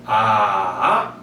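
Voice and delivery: 아 dipping low then rising; third tone. Shown as a demonstration. Teacher showing the third tone, dipping low then up. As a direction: dip 아 down and back up like a Mandarin third tone. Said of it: "떨어졌다가 이렇게 올라갑니다".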